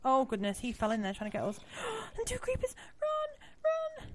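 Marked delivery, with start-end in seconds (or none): 1.6-2.1 sharp high gasp; frightened gasp. Real, not acted. Sees two creepers. 2.2-4.1 high panicked cries; yelling while running away. Real, not acted. Fleeing creepers.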